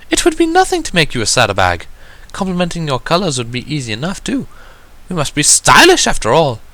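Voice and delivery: a poised unicorn gently reminding her sister; Rarity's voice. Acted.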